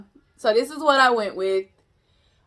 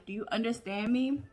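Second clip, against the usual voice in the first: ordinary delivery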